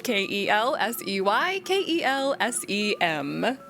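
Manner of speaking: ordinary talk, nothing marked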